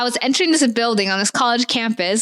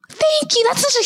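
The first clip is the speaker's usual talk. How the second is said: real high-pitched, gushing thanks; delighted thanks; 14 semitones higher, slower than usual. Real, not acted. Told she's already a funny person, delighted high squeal. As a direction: squeal your thanks at a compliment.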